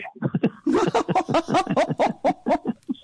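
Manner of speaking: bright rising laugh after the punchline; high laugh. Real, not acted. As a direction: laugh high at your own joke. Laughs at own haiku.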